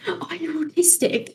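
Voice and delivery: whispered under the breath; mumbled aside. Retold or imitated. Teacher mumbling about a pupil, whispered under her breath. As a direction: mutter it half to yourself, unvoiced. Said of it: "I'm pretty sure she mumbled it".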